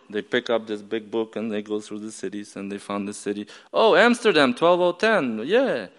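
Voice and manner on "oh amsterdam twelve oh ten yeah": high-pitched voice of pleased officials; excited discovery. Retold or imitated. Officials find Amsterdam in the big book, excited discovery. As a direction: announce the find in a raised pitch.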